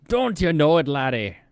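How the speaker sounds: loud, high mock Irish brogue; Irish accent. Streamer hamming up an Irish fighter for chat, mock Irish brogue. Jokey Irish boxer talk, laddie.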